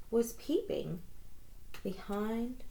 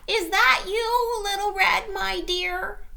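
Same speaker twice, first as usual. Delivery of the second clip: high quavering grandma call; old granny call; 14 semitones higher, 8 dB louder than usual. A narrator's reading. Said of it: "called Granny".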